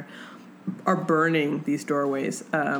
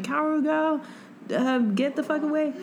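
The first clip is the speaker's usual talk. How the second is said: higher, hammed-up heroic urgency; imitating a frantic hero; mock urgent warning; 9 semitones higher than usual. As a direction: play the hero sending her off. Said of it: "and he's like".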